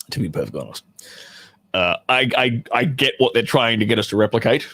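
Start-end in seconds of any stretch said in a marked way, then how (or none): none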